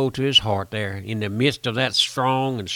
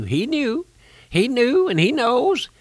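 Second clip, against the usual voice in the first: preaching high; 11 semitones higher, 4 dB louder than usual. Acted. Preaching builds to a high cry.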